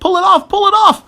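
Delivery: very high, loud screech; terrified high-pitched yell; panicked shriek. A narrator's reading. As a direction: beg frantically for rescue at a shriek. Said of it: "I shrieked".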